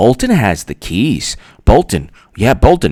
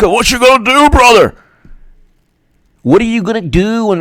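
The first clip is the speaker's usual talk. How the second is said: Hulk Hogan; 9 semitones higher than usual. Retold or imitated. Taunting Bernie fans like Hulk Hogan, hammed-up wrestler shout. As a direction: ham up a wrestling promo taunt. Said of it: "That's like a Hulk".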